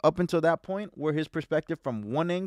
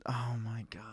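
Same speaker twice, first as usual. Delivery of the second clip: whispered; 3 semitones lower, 10 dB quieter than usual. Real, not acted. Exasperated at friend.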